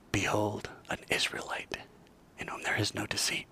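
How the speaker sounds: soft unvoiced whisper. The secret verse whispered as to a rabbi, in a whisper. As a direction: murmur the verse privately. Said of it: "whispering to your rabbi".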